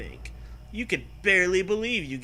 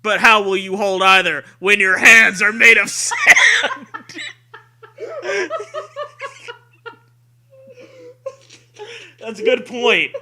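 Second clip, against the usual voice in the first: loud, high spooky trailer voice; hammed-up promo shout; 7 semitones higher, 13 dB louder than usual. Host shouts the blurb like a horror trailer. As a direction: belt it like a campy horror trailer.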